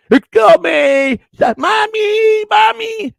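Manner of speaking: put-on very high, loud, wailing child voice; childlike yelling for mommy. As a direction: shriek for mommy like a lost kid. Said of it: "I'd be calling, yelling".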